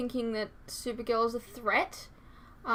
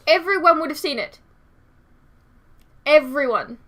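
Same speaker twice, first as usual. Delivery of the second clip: loud, raised insistence; emphatic objection; 4 semitones higher, 10 dB louder than usual. Real, not acted. Reactor objecting to a plot hole, loud and emphatic. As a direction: hit it hard, insisting on the point.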